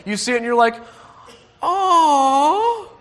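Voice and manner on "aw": high, loud drawn-out aww; long tender aww, hammed-up; sympathetic aw. Shown as a demonstration. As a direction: coo at a crying baby. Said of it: "the noise that you make".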